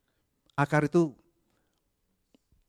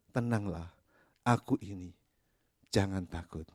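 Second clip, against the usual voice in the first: tender; 6 semitones lower, 6 dB quieter than usual. Acted. Jesus' comforting words quoted.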